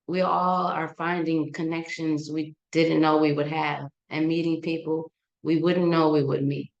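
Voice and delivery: ordinary talk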